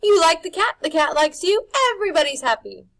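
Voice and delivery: high, loud, sugary salesman charm; polished smile. A narrator's reading. Rictus charms Harvey about the cat with a polished smile, high and sugary. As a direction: gush with a freshly polished smile. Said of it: "freshly polished smile".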